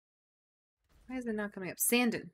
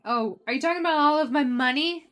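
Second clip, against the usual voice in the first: dramatic; 6 semitones higher, 10 dB louder than usual. Pretend. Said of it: "I'm being dramatic".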